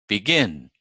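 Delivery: closing fall after stressed GIN in BEGIN; second-syllable stress. Shown as a demonstration. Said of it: "the second syllable is stressed".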